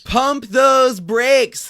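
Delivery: loud, high, stretched-out announcer call; over-the-top show host; hyped segment announcer. Pretend. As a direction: belt out the segment intro, dragging each word.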